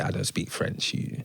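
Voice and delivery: hushed, embarrassed whisper; sheepish whispered aside; mock whisper. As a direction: whisper it like a confession at the table. Retold whisper at fancy restaurant.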